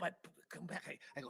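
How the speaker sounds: usual speaking voice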